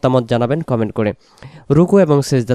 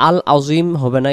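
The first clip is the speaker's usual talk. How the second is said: ordinary talk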